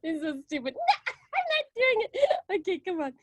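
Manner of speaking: flustered, laughing Diane Keaton voice. Retold or imitated. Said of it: "you just go".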